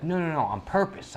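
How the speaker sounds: lightly voiced little boy; imitating the son. Dad mimicking son insisting it was deliberate, lightly. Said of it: "He's like".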